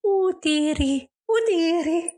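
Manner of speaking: put-on high, slow old nanny voice; hammed-up Mrs Doubtfire. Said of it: "a rock band going".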